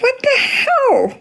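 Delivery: drawn-out, high-pitched mock shock; retold disbelief. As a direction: stretch it out high, acting appalled. Retelling shocked reaction to screaming.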